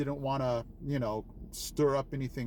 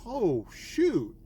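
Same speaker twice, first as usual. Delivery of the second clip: high, loud startled exclamation; retold sudden alarm, pitched up; startled oh shoot; 7 semitones higher, 5 dB louder than usual. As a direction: catch yourself on spotting the wasp. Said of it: "And I'm like".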